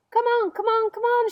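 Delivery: high, loud, hurried shout; impatient shout. Acted. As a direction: yell impatiently, hurry up. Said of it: "shouted the centipede".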